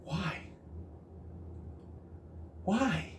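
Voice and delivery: high, soft, drawn-out plea; whiny why. Retold or imitated. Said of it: "it's like".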